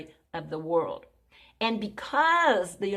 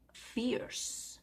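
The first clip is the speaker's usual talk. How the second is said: whispered; 4 semitones higher, 11 dB quieter than usual. Acted. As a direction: whisper fierce with no voice, slowly. Preacher whispers the word fierce.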